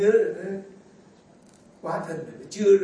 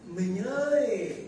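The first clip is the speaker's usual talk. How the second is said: tender call; 3 semitones higher, much slower than usual. Retold or imitated. A priest voicing a wife calling her husband tenderly. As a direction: coo it like a loving wife.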